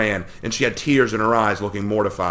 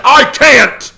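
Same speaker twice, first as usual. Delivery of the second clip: much higher and louder roar; 12 dB louder than usual. A narrator's reading. Mamaragon roars back at his sister, loud roar. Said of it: "he roared back".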